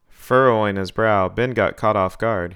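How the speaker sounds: ordinary delivery, nothing marked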